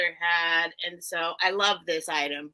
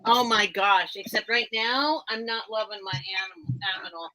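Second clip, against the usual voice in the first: ordinary talk, nothing marked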